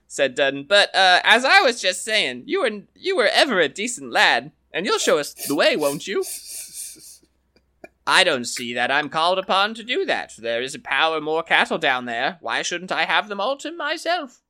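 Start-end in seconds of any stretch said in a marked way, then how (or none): 0.6-6.2 lively higher voices for Donald and the farmer, trading lines; storyteller's character voices. Acted. Dudden wheedles. 8.1-14.4 lively higher voices for Donald and the farmer, trading lines; storyteller's character voices. Acted. Donald refuses.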